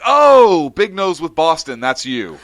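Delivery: high, loud sudden realization; light up as it finally clicks; sudden recognition. Retold or imitated. Barista finally placing the customer, loud and high. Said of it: "She's like".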